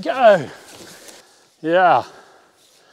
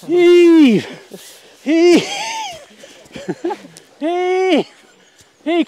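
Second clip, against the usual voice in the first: loud high heave calls; tug of war; 12 semitones higher, 7 dB louder, much slower than usual. Real, not acted. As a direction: shout each heave to pull together.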